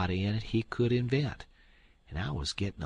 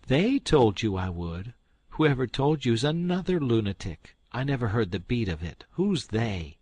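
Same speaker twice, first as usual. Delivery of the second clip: louder indignant scolding; scolding aunt; 3 semitones higher, 6 dB louder than usual. Acted. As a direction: berate the fool.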